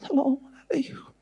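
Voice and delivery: hoarse breathy fake-ascetic whisper; mock saintly whisper; whispered holy man. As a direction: play a frail guru barely whispering. Fake ascetic master greets.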